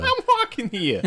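high-pitched mock New York accent; laughing, exaggerated New York accent. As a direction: squawk it like a hammed-up New Yorker. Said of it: "New York accent".

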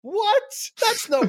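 "What?" shot up high in mock disbelief; squeaky mock outrage. Host mock-outraged at mourning on the podcast, squeaky.

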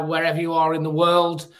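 ordinary talk

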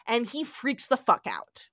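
usual speaking voice

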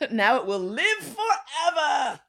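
high, slow, laughing through the words. Real, not acted. Joking with her co-host about the post, laughing. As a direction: say it while still laughing.

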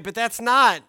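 ordinary talk

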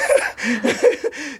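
real high-pitched laugh. Real, not acted. Doctor hoping the mentor hears this, laughing. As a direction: laugh it off after a tender admission.

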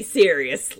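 normal talking voice

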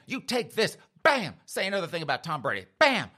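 high, loud taunts between blows; mock fighting yell. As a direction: shout as you land each whack. Attacker yelling between slaps.

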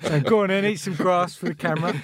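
loud, laughing taunt from the crowd; mock the lonely pony, laughing; jeering onlooker voice. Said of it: "That's not my Welsh accent".

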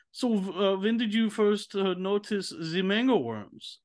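put-on a German veterinarian putting his questions with a German accent; German vet accent. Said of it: "this German vet would be like".

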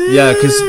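high wailing siren imitation. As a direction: wail it like a klaxon. Mock air raid siren wail.

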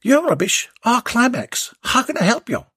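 bright, high, cheery company greeting; high jolly tradesman on the phone. Acted. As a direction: chirp it like a cheerful phone operator. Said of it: "a jolly voice answered".

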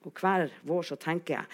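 plain reading voice

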